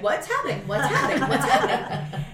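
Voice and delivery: a lightly voiced dog, puzzled and repeating; voicing the dog. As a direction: play the confused dog. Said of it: "Tuki's like".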